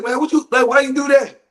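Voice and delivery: high-pitched, aggrieved question; mock-outraged whine at a teammate; acted protest. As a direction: complain loudly in a high voice. Imitated players protest.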